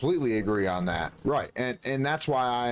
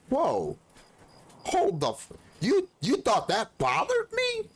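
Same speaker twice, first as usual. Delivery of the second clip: mock disbelief; 13 semitones higher than usual. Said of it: "I'm like".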